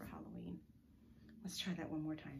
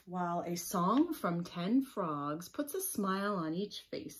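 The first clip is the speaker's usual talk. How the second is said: slow, bright storybook read-aloud; reading to children; 10 dB louder, slower than usual. A narrator's reading. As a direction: read it out to the little ones.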